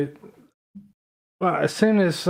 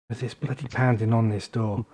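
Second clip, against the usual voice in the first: normal talking voice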